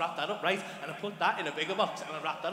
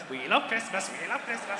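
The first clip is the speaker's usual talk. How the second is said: lightly put-on elf chant; a hint of festive elf voice; little Christmas elves. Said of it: "we were like little Christmas elves".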